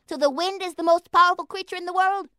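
eager little mouse. A narrator's reading. Lana asking.

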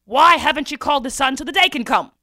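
loud, forceful kingly demand; lion king demanding. A narrator's reading. Lion king questions Mother Owl, booming demand. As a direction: thunder it like an impatient king.

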